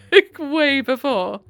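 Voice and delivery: slow, high words after a laugh; real amused laughter. Real, not acted. Reader judging the controlling boyfriend, amused and laughing. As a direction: drag it out through amusement.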